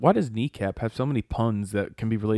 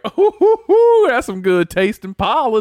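high laughing bee voice; 9 semitones higher, 6 dB louder than usual. Pretend. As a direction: gush about the pollen through laughter. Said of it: "everyone's like".

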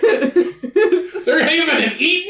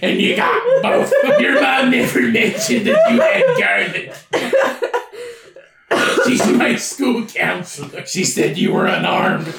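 vampire voice. Retold or imitated. A vampire taunting Ed Kemper in a laughing put-on voice. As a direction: ham up a whiny vampire listing his weaknesses. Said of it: "vampire's like".

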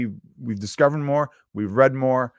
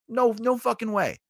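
higher, exaggerated scoffing no; mock disbelief; 10 semitones higher than usual. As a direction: scoff it in a put-on voice. Mocking disbelief.

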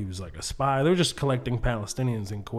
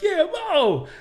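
high, loud cheer; mock crowd cheer; 5 dB louder than usual. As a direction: shout it like a fan starting a chant.

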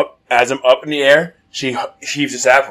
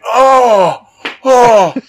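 long high, loud mock wail; imitated pain wail; 13 semitones higher, 8 dB louder than usual. Jumper knocked flat off trampoline, mock wailing in pain. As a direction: howl in exaggerated agony. Retold fall after the hit.

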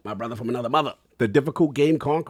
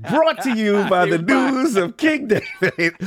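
laughing; 7 semitones higher, 5 dB louder than usual. Real, not acted. Laughing mock sponsor line.

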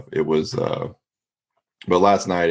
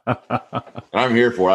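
laughing; 6 semitones higher, slower than usual. Real, not acted. Laughing at the story.

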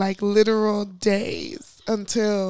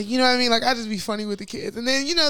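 ordinary delivery

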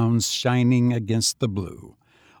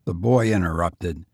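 usual speaking voice

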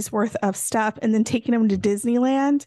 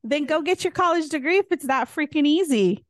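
sassy retort; 6 semitones higher than usual. Retold or imitated. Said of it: "And I'm like".

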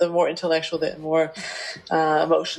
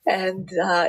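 ordinary delivery

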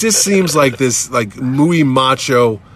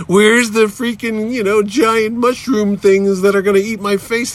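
higher, drawn-out mock outrage; mock indignant demand; 8 semitones higher, slower than usual. Fan demands the mushroom things at Starlin's house, mock indignant. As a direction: demand it in raised pitch, hammed up.

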